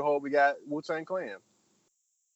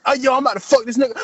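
loud, fast, high threat; mock threat; 8 semitones higher, 10 dB louder, faster than usual. Ghostface threatening the rival at the shoot, loud fast mock threat. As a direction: spit it out fast, ready to fight.

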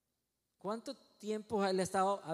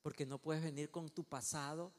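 low, soft, solemn voice; God's hushed command; 4 semitones lower, 9 dB quieter than usual. Acted. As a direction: quietly command with gravity. God voiced in closing command.

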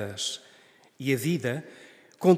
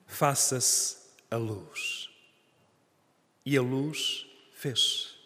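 slow hushed solemn voice; 5 dB quieter, much slower than usual. Acted. God's command read solemnly.

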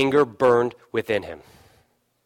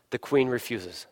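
"The queen refuses" said again, softer and lower; soft emphatic repeat; 4 semitones lower, 9 dB quieter than usual. Shown as a demonstration. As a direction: drop the voice to let it sink in.

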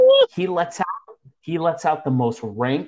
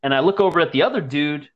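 ordinary talk

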